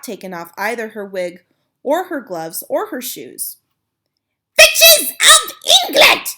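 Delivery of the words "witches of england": even higher, louder, slow screech; shrieking hag summons her coven; witch's yell. Acted. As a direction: scream it over the crowd. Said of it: "she yelled".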